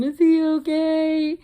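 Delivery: high, loud, slow worried plea; high-pitched anxious is he okay; anxious worried question. Retold or imitated. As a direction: beg to know he is fine. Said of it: "I text Sadie".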